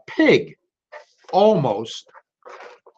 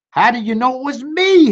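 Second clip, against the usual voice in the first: high howling turkey voice, louder; 9 semitones higher, 4 dB louder than usual. Acted. Turkey caught by Rooster, high mock howl. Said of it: "howled the turkey".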